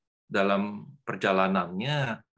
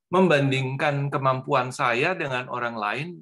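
ordinary talk, nothing marked

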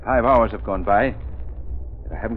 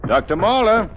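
calling out; 12 semitones higher, 5 dB louder than usual. Acted. Calling louder for the doctor.

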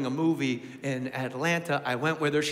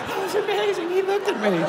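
giddy fan; 13 semitones higher than usual. Retold or imitated. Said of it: "high-fiving".

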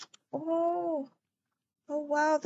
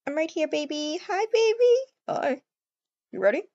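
ordinary talk

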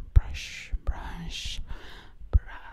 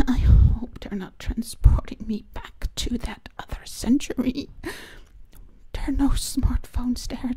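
ASMR whisper; 3 semitones higher, 6 dB louder than usual. Real, not acted. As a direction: whisper close to the mic.

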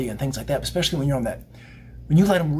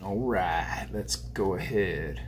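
softer, lower and slow; hushed low restart; low slow murmur; 5 semitones lower, 8 dB quieter, much slower than usual. Real, not acted. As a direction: settle down into a quiet, slow murmur. Quiet slow settling.

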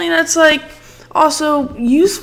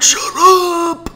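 mock heckler; 9 semitones higher, 3 dB louder than usual. Imagined heckler.

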